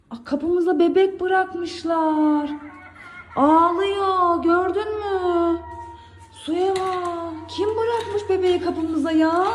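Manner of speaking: drawn-out play-acted surprise; slow, hammed-up disbelief; mock astonishment. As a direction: act amazed for a child, stretching the words. Pretend shock at doorstep baby.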